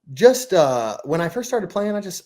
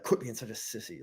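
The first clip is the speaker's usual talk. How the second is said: self pep talk; 10 dB quieter than usual. Retold or imitated. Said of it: "you're like".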